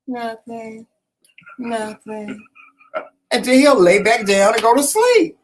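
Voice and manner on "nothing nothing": sleepy mumble. Retold or imitated. Husband answering in sleep.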